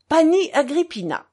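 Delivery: high, loud, indignant address; angry. A narrator's reading. The little pan begins protesting to Grouchenka, angrily. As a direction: start a protest, red with anger. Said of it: "commença le petit pan rouge de colère".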